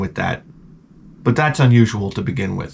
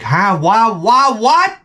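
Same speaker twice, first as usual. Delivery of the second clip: rising higher with each question; escalating bewilderment; 9 semitones higher, much slower than usual. Retold or imitated. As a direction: build to a high, baffled squeak. Bewildered reaction to the music.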